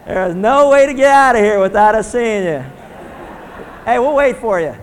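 loud, high playful call across the room; teasing call-out. Real, not acted. Pastor teases the proud mother as she walks out, calling loudly.

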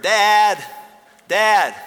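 put-on loud, high child's call down the hall; a small boy calling his dad from bed; little boy calling.

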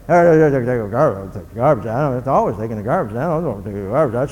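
put-on low grumbling mumble of a put-upon kid. Kid heading out the door with the garbage, grumbling. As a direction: grumble it under your breath on the way out. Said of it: "you hear all the way out the door".

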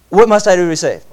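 urgent question. Retold or imitated. Seeker quote again.

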